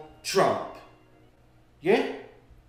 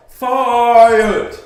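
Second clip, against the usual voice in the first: sudden furious yell, much louder and higher; shout; 7 semitones higher, 14 dB louder than usual. Real, not acted. Ranter demanding the mayor be sacked, furious shout. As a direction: bellow the single word.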